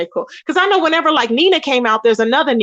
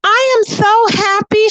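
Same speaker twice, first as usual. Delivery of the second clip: high-pitched, drawn-out mock happiness; feigned happiness; 9 semitones higher, slower than usual. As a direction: imitate someone pretending to be thrilled. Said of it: "trying to pretend she was so happy".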